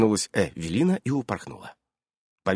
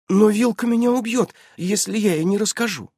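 put-on high, dismayed child's voice; high-pitched, bewildered objection; flustered; 12 semitones higher, 3 dB louder than usual. A narrator's reading. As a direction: protest in confusion like a worried girl. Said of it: "растерялась Эвелина".

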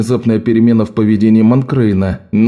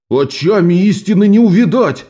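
high, ringing stage declamation; театральная декламация; 8 semitones higher than usual. Acted. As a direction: declaim it like a tragic actor. Актёр декламирует гневно. (громкая театральная декламация)

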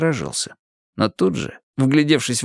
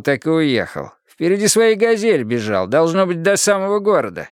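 mocking old watchman; 8 semitones higher than usual. A narrator's reading. Old watchman mocking a smart guy.

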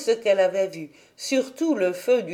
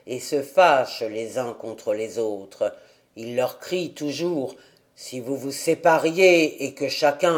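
put-on lower, rough old man; almost savage tone; 7 semitones lower than usual. A narrator's reading. Grandfather explains the hawk's cry, lower and almost savage. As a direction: growl it out wild. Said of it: "Le ton presque sauvage".

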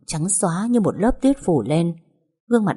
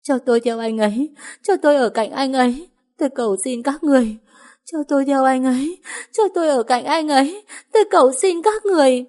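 heartbroken crying; 8 semitones higher than usual. A narrator's reading. Grieving woman pleading with the crowd in high sobs. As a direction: plead again and again through tears. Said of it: "Khóc thương tâm, lặp đi lặp lại".

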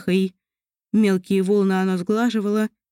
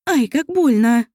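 slow, pained yelp; pained cry; 3 semitones higher, 4 dB louder, slower than usual. Acted. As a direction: cry out from a pricked finger. Elf pricks finger on thorn.

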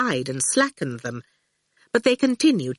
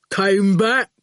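a big, simple-minded henchman voice, a touch louder; slow hulking goon; 3 dB louder than usual. A narrator's reading. Goon grinning at the boy outside school, lightly hulking. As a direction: loom over the boy and state it plainly. The goon looms over Howard.

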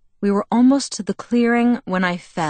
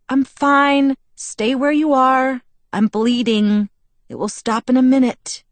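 urgent; 7 semitones higher, 6 dB louder than usual. Acted. Bella bleeding, warding Edward off.

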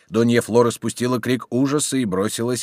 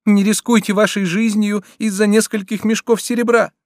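put-on high, loud girlish plea; young daughter pleading with her father, voice lifted high; 10 semitones higher, 4 dB louder than usual. A narrator's reading. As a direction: beg your father to stop risking his life. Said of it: "настаивала девушка".

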